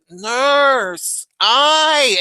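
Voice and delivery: patient whining at the nurse; pained patient. Retold or imitated. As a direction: whine for the nurse again. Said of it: "he's like".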